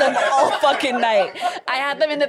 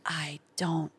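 slow, hushed imitated manager; whispered doubt; 11 semitones higher, much slower than usual. Manager doubts her, retold.

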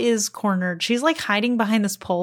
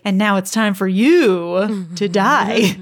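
slow, louder hammed-up threat; cheesy movie villain; 6 dB louder, slower than usual. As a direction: draw out a mock death sentence. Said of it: "That's so cheesy".

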